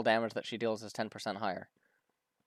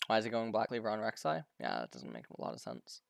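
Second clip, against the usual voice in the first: ordinary delivery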